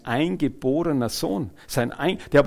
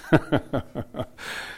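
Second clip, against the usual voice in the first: voiceless, rapid chuckle; 4 dB quieter than usual. Real, not acted. Preacher amused at Sarah laughing over God's promise, chuckling. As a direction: laugh lightly in quick breathy bursts.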